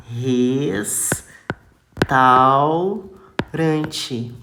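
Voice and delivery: restaurante drawn out very slowly, au held as a diphthong; syllable-by-syllable demo. Shown as a demonstration. Teacher spelling out restaurante for pupils, very slowly. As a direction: stretch every sound of restaurante. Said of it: "Outro exemplo, ó".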